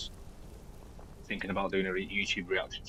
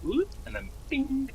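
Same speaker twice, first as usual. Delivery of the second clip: put-on high squeaky anime girl; cute anime squeal. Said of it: "That's my anime impression".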